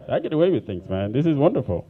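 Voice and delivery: usual speaking voice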